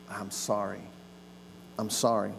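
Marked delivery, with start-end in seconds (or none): 0.1-0.8 soft, lower, heartfelt 'sorry'; sincere apology. Shown as a demonstration. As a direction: say it like you truly mean it. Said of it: "what I meant to say was".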